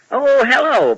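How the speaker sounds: usual speaking voice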